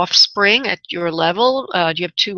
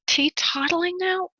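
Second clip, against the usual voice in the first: puzzled echo; 9 semitones higher, 6 dB quieter than usual. Real, not acted. Medium puzzled by the phrase.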